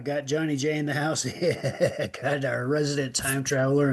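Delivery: ordinary talk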